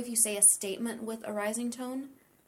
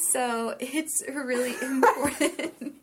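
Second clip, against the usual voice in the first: laughing; 3 semitones higher, 5 dB louder than usual. Real, not acted. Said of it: "Your relationships are hinging on it".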